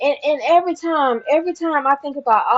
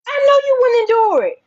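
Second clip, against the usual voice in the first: higher and louder, leaning on know; defiant taunt; 7 semitones higher, 4 dB louder than usual. Real, not acted. To her accusers, defiant, higher and louder. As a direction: throw it at them.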